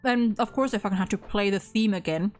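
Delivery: ordinary talk, nothing marked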